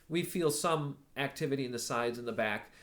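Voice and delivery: ordinary delivery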